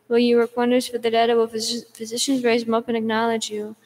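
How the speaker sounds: ordinary talk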